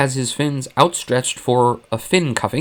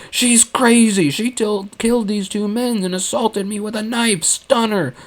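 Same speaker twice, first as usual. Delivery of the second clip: scream; 9 semitones higher than usual. Acted. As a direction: yell for the guards in alarm. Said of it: "Akbar screamed".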